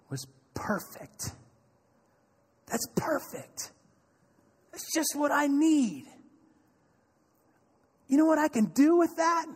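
slow, hushed then rising wonder; dramatic pause. Acted. Preacher revealing Jesus' response, slow and hushed then rising. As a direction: build it up slowly.

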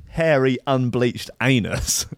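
unbleached anus drawn out slowly; slow, savoured delivery. Acted. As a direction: linger over every word, deadpan. Savoring the crude punchline.

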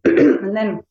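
hoarse, catching throat; talking through a frog in the throat. Real, not acted. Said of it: "I've got a real frog in my throat".

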